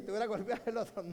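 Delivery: replaying the brother's joke in an amused, put-on voice; mock teasing brother. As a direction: ham up the brother's quip with a grin. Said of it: "Imagínese, me dice".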